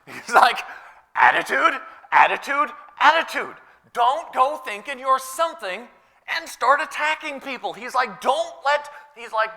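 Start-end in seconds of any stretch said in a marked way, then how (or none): 1.1-7.7 loud, high, insistent preaching; forceful retold warning. Acting out the apostle's warning. 8.2-8.9 urgent retold plea. Acting out the apostle's plea.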